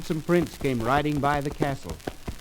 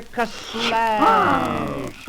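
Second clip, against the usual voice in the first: usual speaking voice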